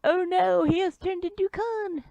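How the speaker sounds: very high small voice; alarmed sidekick. Acted. Sidekick sees his boss turn into Khan, high-pitched. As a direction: squeak out the alarm.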